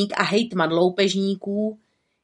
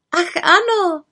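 higher witch voice, eager; a light young witch voice; lightly raised little witch voice; little witch exclaiming; 9 semitones higher than usual. A narrator's reading. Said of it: "zvolala malá čarodějnice".

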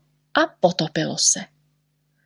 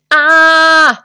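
loud, high "aah" scream; sudden loud acted yell; scream of pain; 9 semitones higher, 8 dB louder than usual. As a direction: shriek as the sting hits. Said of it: "Nekřič, nekřič".

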